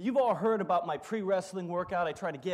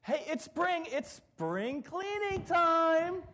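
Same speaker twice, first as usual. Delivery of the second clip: much higher, sing-song, drawn out; cheery mom; 11 semitones higher, slower than usual. Retold or imitated. Mom announces spring cleaning to her kids, hammed-up cheerful. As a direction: chirp it like an upbeat mom. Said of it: "you remember your mom?".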